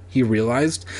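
plain reading voice

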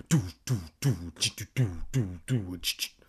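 low hummed doo doo with clicks; buzzing noises. Acted. Kermit making a buzzing machine noise, low hum. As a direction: imitate a droning gadget sound.

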